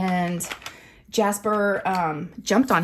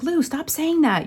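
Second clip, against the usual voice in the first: stern scolding; 10 semitones higher, slower than usual. Retold or imitated. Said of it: "I was like".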